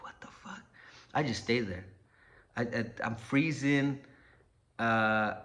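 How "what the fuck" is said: whispered under the breath; hushed disbelief. Retold or imitated. Hiding man reacts to the dog threat, whispering to himself. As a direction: whisper it to yourself while hiding.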